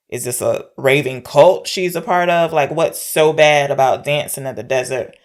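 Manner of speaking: normal talking voice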